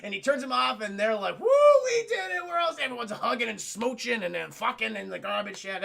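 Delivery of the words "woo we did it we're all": high, loud whooping celebration; jubilant cheer. Retold or imitated. Friends saved from the compactor, ecstatic cheer. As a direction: whoop it out in triumph.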